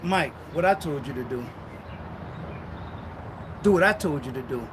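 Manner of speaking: low, firm, deliberate command; low voice of God laying down the law. Acted.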